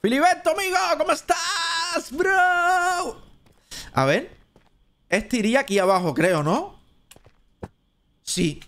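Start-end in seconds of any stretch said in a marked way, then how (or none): 0.0-3.2 very high, drawn-out greeting; excited hailing. Real, not acted. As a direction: hail a buddy in a high, held squeal. Hails an arriving viewer by name.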